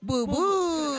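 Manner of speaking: 1 mock cow sound. Cow joke punchline moo.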